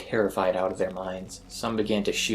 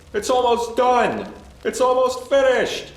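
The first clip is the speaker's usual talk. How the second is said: high, loud, slow manic scream; 9 dB louder than usual. A narrator's reading. Burning man to the crowd, hysterical scream. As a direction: shriek it in burning frenzy. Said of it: "he was screaming".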